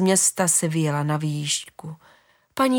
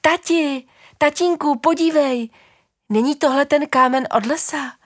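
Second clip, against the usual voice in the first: put-on high, bright-eyed child voice, a bit louder; excited small child calling; 8 semitones higher, 4 dB louder than usual. Small child calls father on the path, high excited child voice. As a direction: call out to dad like a thrilled little kid. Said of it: "zvolalo menší dítě".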